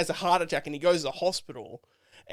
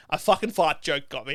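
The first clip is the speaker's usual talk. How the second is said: normal talking voice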